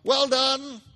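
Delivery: high, loud cheer; cried out. A narrator's reading. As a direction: cheer the dancers to a stop. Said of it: "cried out".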